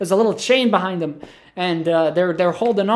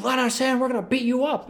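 mock mob threat; 8 semitones higher than usual. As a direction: imitate a gang clamouring to get in. Mob threatening outside the plane.

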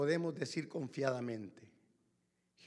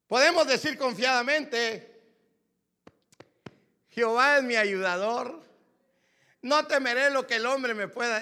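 loud, high proclamation of the verse; full-voiced scripture call; preaching scripture; 10 semitones higher, 14 dB louder than usual. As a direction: declare it to the whole church.

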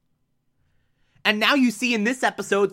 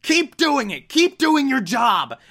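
raging yell; 7 semitones higher than usual. Retold or imitated. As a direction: scream it at her like an abusive boyfriend. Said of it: "just yelling at her".

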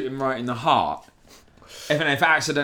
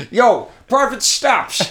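mock army nickname; 8 semitones higher, 7 dB louder, slower than usual.

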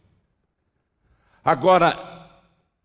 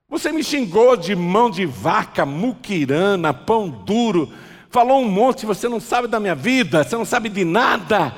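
raised voice, building to a louder protest; indignant rebuke; 4 semitones higher, 4 dB louder than usual. Acted. Insulted passerby rebuking the man who cursed him, indignant. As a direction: list the insults with mounting indignation.

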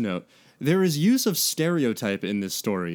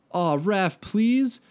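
pleading whine; 9 semitones higher, much slower than usual. A narrator's reading. Mike begging Raph.

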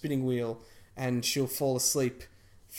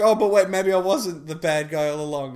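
raised, louder voice of feigned innocence; mock realization; 4 semitones higher, 9 dB louder than usual. Villain claiming she wasn't the bad guy, mock innocent. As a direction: play the villain suddenly doubting her own guilt. Acting villain's sudden change of heart.

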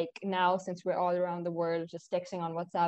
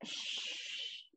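whispered shush; 10 dB quieter than usual. Real, not acted. Hushing co-host on mic.

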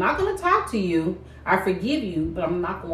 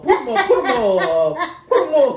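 loud, higher mock tough-guy challenge; Cowardly Lion bluster; 5 semitones higher, 7 dB louder than usual. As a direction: ham up the scared lion spoiling for a fight. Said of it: "That's the Wizard of Oz".

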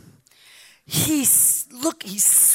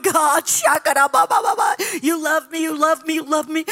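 desperate prayer; 3 semitones higher, 6 dB louder than usual. Retold or imitated. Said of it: "just going".

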